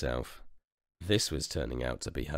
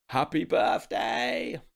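household cheering; slower than usual. Acted. Household celebrates together.

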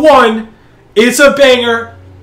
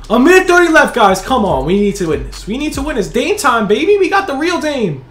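ordinary delivery

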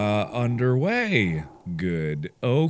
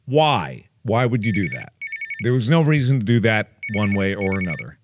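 ordinary delivery

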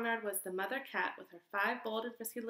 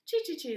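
high, louder chattering chee chee; squirrel chatter; 6 semitones higher, 5 dB louder than usual. Acted. Red squirrel chatters back at the puppy, high chatter. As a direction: chatter like a cross little squirrel. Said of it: "the squirrel chattered back".

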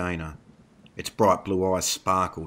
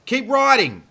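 loud, very high urgent command; shouted order; 8 dB louder than usual. A narrator's reading. Dana urges panicked Marco onward, shouted order. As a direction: drive them on with a shout. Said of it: "Dana ordered".

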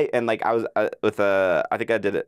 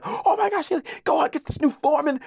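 much higher, squealing fan excitement; fan freaking out; 3 dB louder than usual. Retold or imitated. Reacting as a fan to the movie's ending, squealing with excitement. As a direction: gush like a kid seeing the ending. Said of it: "freaking out as a fan".

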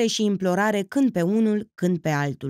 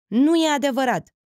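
heated denial, rising; 7 semitones higher, 4 dB louder than usual. A narrator's reading. Felix insists again, higher and heated. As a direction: repeat the denial higher and louder. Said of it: "negă Felix aprins".

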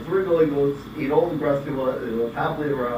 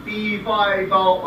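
dopey cartoon bellow; 6 semitones higher, 5 dB louder, much slower than usual. Giant's catchphrase mimicked.

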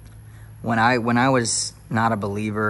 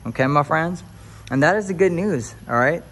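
preacher climax; 4 semitones higher, 5 dB louder than usual. Acted. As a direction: lean into the church call-and-response. Preaching to viewers.

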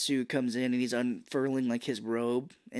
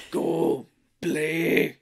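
Tatsu; 4 semitones higher, 6 dB louder than usual. Retold or imitated. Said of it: "not his voice is like".

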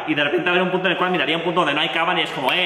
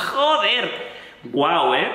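laughing; 3 semitones higher, much slower than usual. Real, not acted. Reacting to lyrics, laughing.

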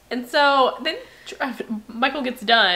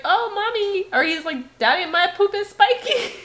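put-on child's voice; 10 semitones higher than usual. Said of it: "he was like".

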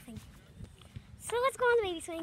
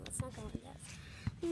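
whisper; 5 semitones lower, 14 dB quieter, slower than usual. Real, not acted. Whisper near puddles.

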